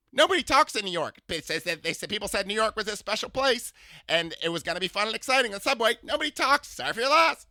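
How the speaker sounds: high, loud, sing-song camp voice; imitating a flamboyant older man. To a stranger on the subway, gushing camp impression. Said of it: "I imitate people".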